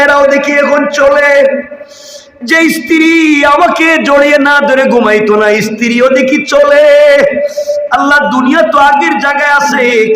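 high, insistent cry of the dead man; impassioned preached lament. Acted. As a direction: lament at a high, sustained pitch. Dead man's lament, preached intensely.

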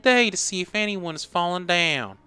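put-on Southern sweet old lady. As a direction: coo like a kindly Southern grandma. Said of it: "Southern sweet old lady".

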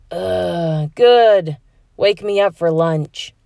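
put-on groggy voice of a grumpy teen; grumpy sleepy teen. A narrator's reading. Heather in bed, groggy grumbling teen. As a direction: brush him off half asleep.

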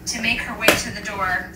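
ordinary talk, nothing marked

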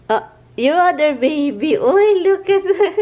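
high, loud, drawn-out exclamation; delighted teasing. Real, not acted. Teasing partner about the other baby, delighted high exclamation. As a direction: exclaim slowly, rising toward a laugh.

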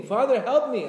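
high, loud pleading cry; imitated screamed prayer. Pilgrim in Uman praying to the Father, screamed plea. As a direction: cry out at full voice for help. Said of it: "They're screaming".

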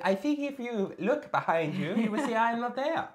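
high, pompous lecturing voice; mock posh gentleman. Streamer voicing a chatter as a pompous gentleman. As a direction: lay out the logic like a stuffy gentleman.

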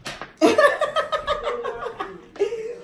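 high burst of laughter. Real, not acted. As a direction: laugh hard. Laughing at partner.

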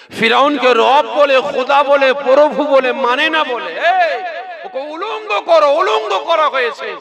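high, loud impassioned preaching; preaching fervour. Acted. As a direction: build the outrage, calling out high and loud.

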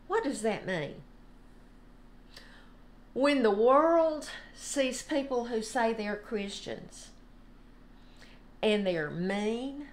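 ordinary delivery

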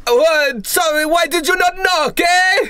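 a boss caught with his trousers down, furious; angry mob boss. Acted. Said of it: "he goes".

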